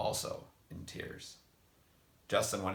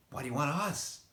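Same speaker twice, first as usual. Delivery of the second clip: high, loud frightened cry; frightened question; 9 semitones higher, 4 dB louder than usual. A narrator's reading. Girl demanding answers from the strange man, afraid. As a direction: plead in fear with the stranger.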